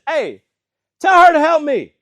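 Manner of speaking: high, very loud exasperated yell; acted shout of a fed-up sister; frustrated outburst. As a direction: explode in hammed-up frustration. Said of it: "finally she gets frustrated".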